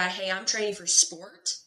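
ordinary talk

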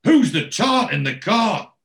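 loud, high, drawn-out bellow; screamed at top of voice. Retold or imitated. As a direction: act out yelling it through the house. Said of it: "screams at the top of his voice".

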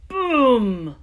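booming knock; loud boom. Acted. Knocking at the door.

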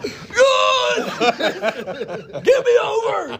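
choked high yell, laughing; strangled high shout. Retold or imitated. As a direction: squeal it, gasping and laughing. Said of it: "I'm like".

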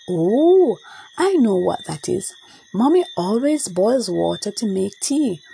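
ordinary delivery, nothing marked

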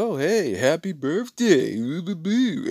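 high, singsong birthday greeting; cheery waiter. Retold or imitated. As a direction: put on a slow, high waiter voice.

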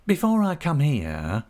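high, wheedling and obsequious; cringing humble clerk. A narrator's reading. Uriah humbly asking Creakle leave to write to mother. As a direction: creep and fawn as you ask.

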